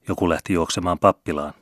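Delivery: plain reading voice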